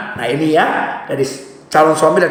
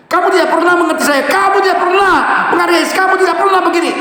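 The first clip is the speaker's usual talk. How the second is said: accusing shout; 11 semitones higher, faster than usual. Shown as a demonstration. Example of words to avoid with a spouse, shouted high. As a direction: nag with rising anger.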